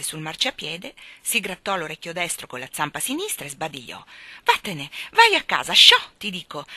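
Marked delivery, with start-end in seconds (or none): 4.4-6.6 loud, cross shooing; angry shout. A narrator's reading. Miss Lark ordering the stray dog home, shouting angrily. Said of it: "gridò Miss Lark agitando rabbiosamente le braccia".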